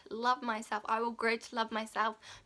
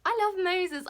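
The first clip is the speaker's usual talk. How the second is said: excited; 8 semitones higher, 6 dB louder than usual. Real, not acted. Excited burst about Moses.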